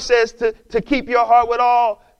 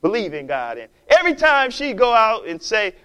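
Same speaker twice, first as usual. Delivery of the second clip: ordinary talk, nothing marked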